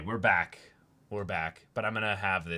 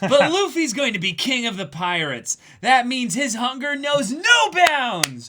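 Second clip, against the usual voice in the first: higher, louder boast; proud excited declaration; 14 semitones higher, 10 dB louder than usual. Acted. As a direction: proclaim it like a cheering fan. Dubbing Nami lines.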